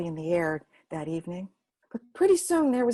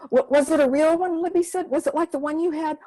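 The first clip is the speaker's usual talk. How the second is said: much higher and louder eager questions; eager child; 8 semitones higher, 7 dB louder than usual. Acted. Libby eagerly asking Gima about her shivaree.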